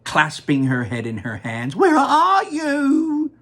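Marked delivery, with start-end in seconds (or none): 1.7-3.3 high, slow, loud sobbing wail; wailing mother. Acted. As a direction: drag the words out through tears. Mother wailing for lost son.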